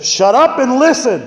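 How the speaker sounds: high, loud, slow angry command; frustrated shout. Retold or imitated. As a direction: bark it slowly to cut through her noise. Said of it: "I got so frustrated".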